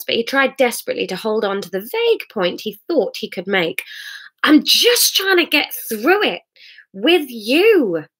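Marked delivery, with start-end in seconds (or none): 4.4-8.1 higher, slightly raised plea; pleading man. Acted. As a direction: try to reach her, pleading. Hamish pleading, drunk.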